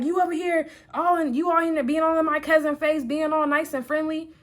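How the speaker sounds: put-on high-pitched accusing boyfriend; retold heated accusation; arguing. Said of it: "like, we're arguing".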